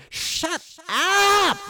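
very high, loud yell; exasperated full-force scream; telepathic shout. Acted. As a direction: shout as strongly as you can. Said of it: "telepathically shouts in Finnick's mind".

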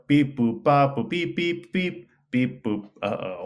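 mechanical beeping, robot noises; toy robot voice. Acted. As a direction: beep like a little toy robot. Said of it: "make some beep, boop noises".

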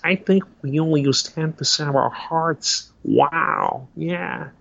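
laid-back surfer musing with a drawn wow; lightly voiced Owen Wilson; Owen Wilson impression. Impressionist does Owen Wilson musing about hearts, laid-back. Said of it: "people love hearing Owen Wilson".

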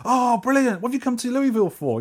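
high-pitched, gushing imitation; delighted stranger. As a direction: act out an eager, friendly local. Acting out friendly stranger's delight.

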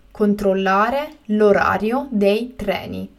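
slow and carefully spaced; slow, clear repetition. Shown as a demonstration. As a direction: say it again slowly for learners. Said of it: "teacher repeats the word twice".

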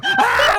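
sharp high yelp of pain. Retold or imitated. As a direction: yelp as the scalp burns. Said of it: "I was like".

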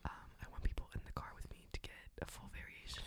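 low ASMR whisper. Real, not acted. Whispering her reason to the host in ASMR style. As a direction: breathe the answer in a low whisper. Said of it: "no one wants to hear you whisper".

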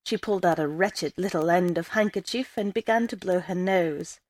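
ordinary delivery

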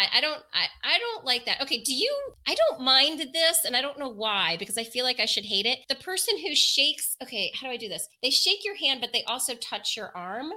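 ordinary talk, nothing marked

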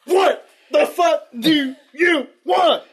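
put-on high, slow, snarling teen voice; angry snarl. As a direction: snap back at him, dragging it out. [name] snapping in character.

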